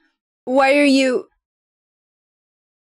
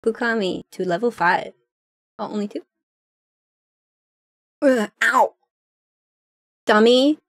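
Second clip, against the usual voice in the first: normal talking voice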